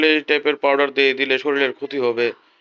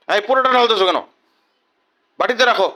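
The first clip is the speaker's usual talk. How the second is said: louder, higher scolding snap; sharp scolding; 6 semitones higher, 5 dB louder than usual. Real, not acted. Father scolding his child for pouring out the whole powder, sharp and loud.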